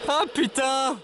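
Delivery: high burst of relief and joy; excited. Real, not acted. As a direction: cry out with delight. Hears the radio after isolation.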